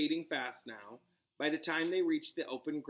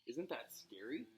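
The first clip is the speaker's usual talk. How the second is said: hushed aside; 11 dB quieter than usual. Real, not acted. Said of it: "asks pets, hushed".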